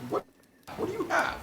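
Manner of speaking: high fussy robot butler voice; prissy high-pitched droid; C-3PO voice. Retold or imitated. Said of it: "C three P O is like".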